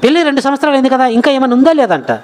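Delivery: imitating gossips, high and quick; petty prying. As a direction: needle her like a nosy neighbour. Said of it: "ఎంత చిల్లిగా మాట్లాడుతుంటారండి". (ఎత్తిపొడిచే ఇరుగుపొరుగు అనుకరణ)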